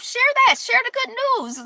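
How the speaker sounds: high, bright rallying call; rally the viewers to share; lifted upbeat urging; excited call. Real, not acted. Cheerful call to share news.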